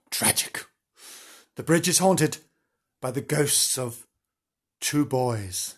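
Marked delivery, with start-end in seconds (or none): 0.1-0.6 whispered sorrow. A narrator's reading.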